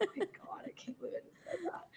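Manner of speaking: high, very soft whispered shock; hushed disbelief. Retold or imitated. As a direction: act out being mortified, almost under your breath. Retells shock at own confession.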